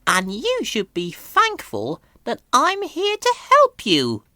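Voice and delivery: put-on high, condescending old lorry voice; haughty old steam lorry; Elizabeth. A narrator's reading. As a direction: look down on the little puffer. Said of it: "replied Elizabeth".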